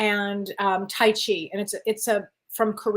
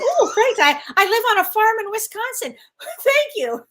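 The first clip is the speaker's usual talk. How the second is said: much higher, giddy retold thanks; mock-flattered reply; 11 semitones higher, 5 dB louder than usual. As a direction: squeal it a little, laughing at yourself. Retells her bashful reply to compliment.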